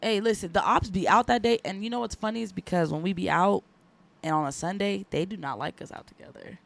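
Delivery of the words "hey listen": usual speaking voice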